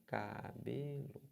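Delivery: "cabelo" slow and soft, split ca-be-lo; syllable by syllable. Shown as a demonstration. Teacher counts the syllables for students, slow syllable-by-syllable reading. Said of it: "você tem três sílabas".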